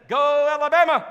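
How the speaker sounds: loud, high Alabama fan shout; Perry shouting for his Alabama team; fan cheer. Retold or imitated.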